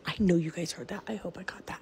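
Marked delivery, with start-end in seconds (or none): 1.1-1.8 hushed and spooked; scared whisper. Real, not acted. Filming alone at night, whispering scared. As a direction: whisper it, frightened, so nothing hears.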